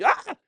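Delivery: sudden high, loud "ah!"; yelp of pain. Acted. Teacher acting out hot cholent on a finger, pained yelp. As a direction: yelp as if scalded.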